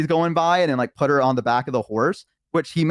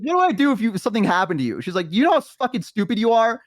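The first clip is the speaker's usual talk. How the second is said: upset friend; 9 semitones higher than usual. Retold or imitated. Elf friend scolding Walter for nearly dying, high and upset. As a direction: plead and scold in a raised pitch.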